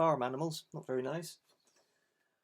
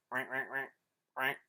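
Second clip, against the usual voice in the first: quacking; 3 semitones lower, 3 dB quieter than usual. Shown as a demonstration. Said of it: "Can you quack, boys and girls".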